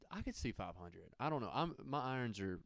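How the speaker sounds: ordinary talk, nothing marked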